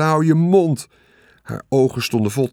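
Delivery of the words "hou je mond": high, loud exasperated cry; angry shriek. A narrator's reading. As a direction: shriek it to shut someone up. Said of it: "gilde Veerle". (boos gillen)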